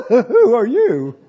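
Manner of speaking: startled, laughing. Shown as a demonstration. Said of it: "I think he said".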